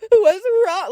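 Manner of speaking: high, tearful mock whimper. Scared brother calling to his hurt sister, whimpering. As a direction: imitate a frightened little brother crying. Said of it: "I could just hear him, like, whimpering".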